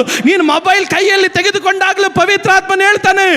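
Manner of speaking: ordinary talk, nothing marked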